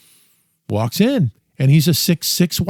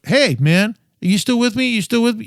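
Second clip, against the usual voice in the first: higher, coaxing check-in to a dying father; gently calling to dad; 7 semitones higher than usual. Retold or imitated. Talking to his dying dad.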